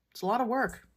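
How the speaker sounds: usual speaking voice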